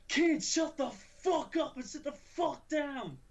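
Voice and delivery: high, slow, shifted mock shout; slow, high, hammed-up yell at a classmate; retold angry yell. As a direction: bark it at the kid, drawn out. Retold confrontation, face to face.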